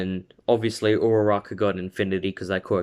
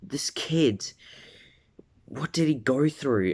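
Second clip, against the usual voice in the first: shocked gasp; 5 semitones higher, 3 dB quieter than usual. Retold or imitated. Shocked classmates seeing scars.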